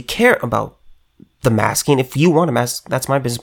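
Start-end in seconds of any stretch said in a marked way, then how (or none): none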